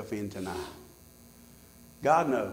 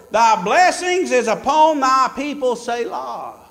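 preaching; 9 semitones higher, 10 dB louder than usual. Acted. Preaching verse eight.

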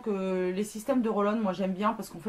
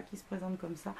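ordinary delivery

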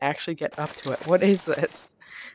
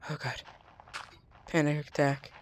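ordinary delivery

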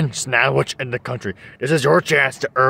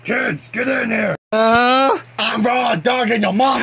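yelling; 10 semitones higher than usual. Acted. Parent yells to kids.

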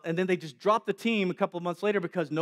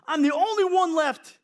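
higher, louder desperate complaint; anguished cry; 10 semitones higher, 6 dB louder than usual. Acted. Elijah complaining to God, raised and anguished. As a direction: cry it out in despair.

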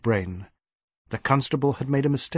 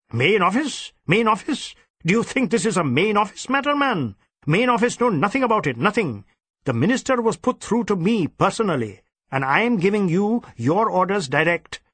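indignant; 7 semitones higher than usual. Acted. Superintendent scoffing at his inspector's question, indignant. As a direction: scoff at the very idea.

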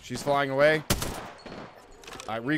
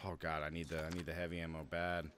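muttering; 9 semitones lower, 15 dB quieter than usual. Real, not acted. Muttering low on ammo.

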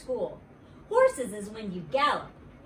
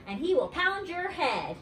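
higher-pitched, mild yelled threat; yelling; 7 semitones higher than usual. A narrator's reading. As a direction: warn someone off in a child's yell. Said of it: "I yelled".

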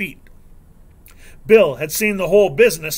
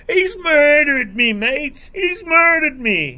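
high, drawn-out wailing yell; hammed-up cry of agony, slow and high; screeching yell; 11 semitones higher, 5 dB louder, slower than usual. A narrator's reading. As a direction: wail it out like a stuck pig. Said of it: "he yells".

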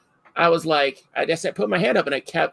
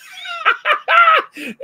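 sudden shrieking laugh, very high and loud; a big high laugh shout; loud burst of laughter; 8 dB louder than usual. Retold or imitated. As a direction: explode into a howling cackle. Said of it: "and I went".

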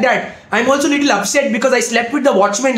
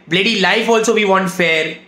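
ordinary talk

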